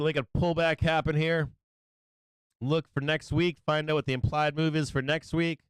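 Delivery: normal talking voice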